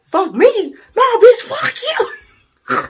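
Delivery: shrieked, very high, hammed-up outrage; escalating cussing match. Storyteller tops his date's curse, hammed-up screaming outrage. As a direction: yell it comically, dragging out the last retort. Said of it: "it escalated really quickly".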